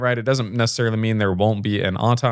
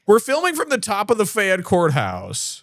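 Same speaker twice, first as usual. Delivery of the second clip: higher, hammed-up big announcer; lightly put-on showman voice, pitched up; mock announcer voice; 8 semitones higher than usual. Host joking about the new set, in a higher hammed-up announcer voice.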